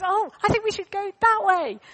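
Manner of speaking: a hint of an excited little boy; eager boy. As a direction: blurt it out like a bold kid. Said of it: "And he goes".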